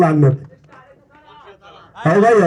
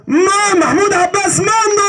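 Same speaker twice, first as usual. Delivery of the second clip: excited name call; 9 semitones higher than usual. Real, not acted. Announcer naming the second-place winner.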